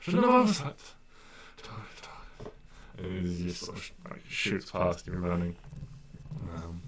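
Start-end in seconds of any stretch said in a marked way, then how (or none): 0.0-0.8 higher, fretting runner late for an event; flustered latecomer. Acted. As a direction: fret to yourself as you rush past. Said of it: "You see the funny one going".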